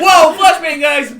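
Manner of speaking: high laughing yell; excited laughing yell. Retold or imitated. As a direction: shout it high while laughing. Retold teammate warning.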